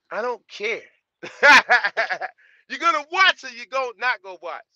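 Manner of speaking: high, loud, laughing brush-off. Real, not acted. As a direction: toss it away with a big laugh. Laughing off whether they watch.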